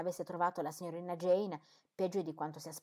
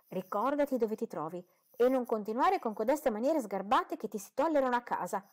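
scolding exclaim; 5 semitones higher, 5 dB louder than usual. A narrator's reading. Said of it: "esclamò sua madre".

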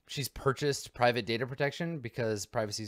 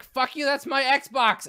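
high, loud, drawn-out yell; kid's angry shout; 8 dB louder, slower than usual. Acted. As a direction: yell like a squabbling kid next door. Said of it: "you hear a shout from the room".

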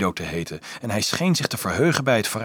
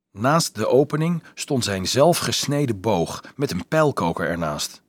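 usual speaking voice

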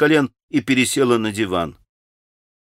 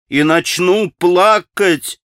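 slow, higher and louder repeat; the second time more insistent; tearful, rising; 7 semitones higher, 5 dB louder, much slower than usual. A narrator's reading. Grushenka answering Rakitin's taunt, insisting she'll cry. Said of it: "приговаривала Грушенька".

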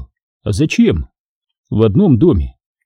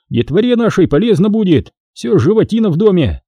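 higher, persuading voice; pleading lieutenant; 7 semitones higher than usual. Acted. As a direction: coax the boss. Lieutenant keeps asking.

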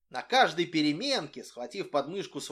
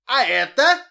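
higher and much louder teasing call; 9 semitones higher, 14 dB louder than usual. A narrator's reading. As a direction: dangle it in front of her. Boy teasing with letter.

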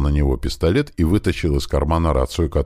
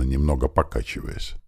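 ordinary delivery

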